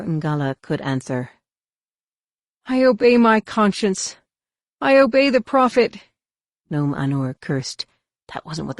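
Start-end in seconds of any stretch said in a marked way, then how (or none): 2.6-6.0 high, strained refusal; a captive heretic's high, strained defiance; defiant prisoner. A narrator's reading. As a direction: refuse him outright.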